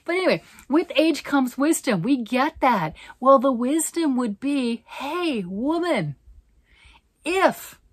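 high, loud scolding voice of wisdom; calling herself woman, loud and high; hammed-up self-lecture. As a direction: scold yourself as wise advisor.

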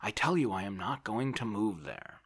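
understated irritated protest; irritable refusal. A narrator's reading. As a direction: put your foot down peevishly. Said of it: "said Oblomov irritably".

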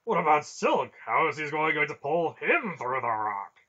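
high, loud, urgent questioning; agitated protest. A narrator's reading. Barak challenging the plan for Silk, agitated protest. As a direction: burst out with loud objections.

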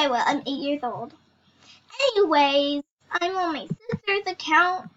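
ordinary talk, nothing marked

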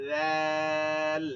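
ল্যাল held long and soft; soft drawn letter. Shown as a demonstration. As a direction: let ল্যাল ring out slowly and softly. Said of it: "নরম আওয়াজ". (নরম আওয়াজে টেনে ল্যাল)

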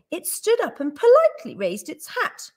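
usual speaking voice

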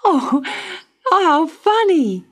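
put-on amused childlike cry; little girl finding the trial funny; Dot amused. A narrator's reading. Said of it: "said Dot".

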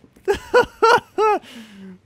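shrieking laugh, very loud; loud high laughing burst. Real, not acted. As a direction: explode into laughter.

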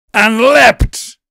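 high, loud burst on the leap; dramatic narration. A narrator's reading. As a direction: punch the climax of the attack. Narration peaks at attack.

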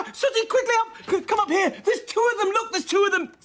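high-pitched urgent calling; agitated, voice way up; frantic excitement. Acted. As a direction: summon someone to see the unbelievable. Calls Sooty to see two dogs.

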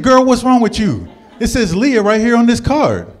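high, loud incredulous protest; exasperated yell. Retold or imitated. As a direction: shout in disbelief at her. Exasperated retort.

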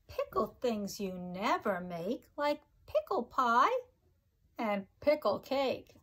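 sing-song rhyming read-aloud, each line bouncing; reading to children. Acted. Teacher reading a pickle rhyme book to her class, sing-song. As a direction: bounce the rhymes for little listeners.

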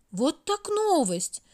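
drawn-out surprised exclaim. A narrator's reading. May amazed at the stopped hands, slow and surprised. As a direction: react slowly, amazed. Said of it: "удивился Май".

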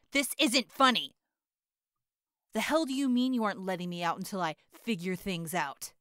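hot-tempered rant, shouted; angry yelling. Acted. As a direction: yell threats through the locked door. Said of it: "when I was yelling at Raccoon Eyes".

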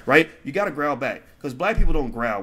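usual speaking voice

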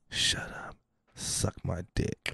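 hushed, low mock-intimate murmur; murmured in the ear. Barber leaning into his client's ear, imitated creepy whisper. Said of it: "He, he comes in my ear, he goes".

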